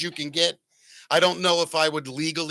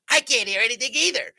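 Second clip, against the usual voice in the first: higher, squeaky cartoon mouse voice; Minnie Mouse voice; 8 semitones higher, 3 dB louder, faster than usual. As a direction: sound like you just breathed helium. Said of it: "we both sounded like Minnie Mouse".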